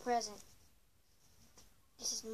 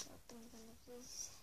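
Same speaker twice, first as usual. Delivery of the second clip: normal talking voice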